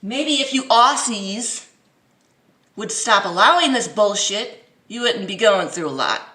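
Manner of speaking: scolding. Real, not acted. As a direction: tell them off.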